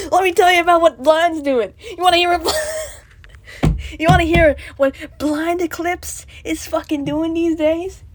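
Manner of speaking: loud laughing shout. Real, not acted. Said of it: "I should stop shouting".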